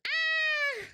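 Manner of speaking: high cat-like yell imitating her cat; cat scream. Streamer imitating her bored cat screaming, high squeal. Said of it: "He goes".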